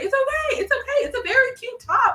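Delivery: very high, cooing reassurance; comforting customer. Retold or imitated. Customer hugging the crying seller, high consoling voice. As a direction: imitate a squeaky, soothing stranger.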